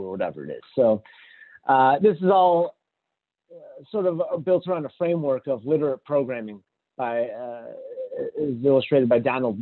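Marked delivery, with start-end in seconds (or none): none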